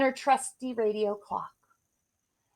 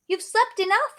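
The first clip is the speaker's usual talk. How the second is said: higher, louder insistent call; 11 semitones higher, 6 dB louder than usual. Acted. Beauty to her parents, high insistent call. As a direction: urge them up, high and loud.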